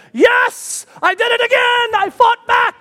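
high, loud victory cry; triumphant shout. Retold or imitated. After landing the skydive he celebrates, triumphant shouting. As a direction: shout it like you just beat your fear.

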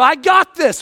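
mock bravado; cocky reply. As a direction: overconfident dad insisting he can handle it. Overconfident father reply.

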